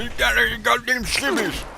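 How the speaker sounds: high, garbled toothless southern voice; mock holler with no teeth; screaming southern boss. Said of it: "he starts screaming into the radio".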